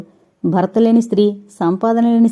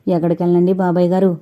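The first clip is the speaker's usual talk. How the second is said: usual speaking voice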